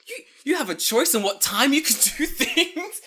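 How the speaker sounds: high, loud, astonished question; laughing disbelief at lenient parents; incredulous laughing. Retold or imitated. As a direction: act stunned at the idea. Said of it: "I'm like".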